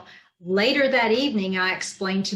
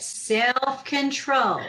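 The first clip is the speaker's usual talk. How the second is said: self-control drawn out slowly and calmly; steady yourself as you say it; slow and deliberate; 2 semitones higher, much slower than usual. Acted. Telling her nervous landlady to stay calm, slowly drawn out. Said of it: "We've got to show a little self-control".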